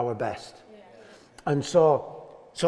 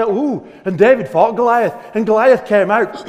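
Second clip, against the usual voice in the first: put-on telling a children's story; 3 semitones higher, 7 dB louder than usual. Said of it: "stories in the Bible that you tell children".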